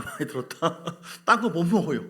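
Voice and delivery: real laugh breaking into the words. Real, not acted. Preacher amused at own ramen analogy, laughing.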